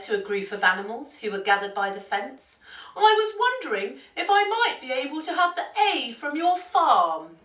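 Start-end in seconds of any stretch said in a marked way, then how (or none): 2.9-7.4 high, quick storybook character voice; duck's polite request. A narrator's reading. Duck asking farm animals for a letter, high character voice. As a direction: ask hopefully, pitched well above narration.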